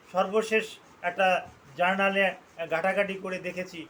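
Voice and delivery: ordinary talk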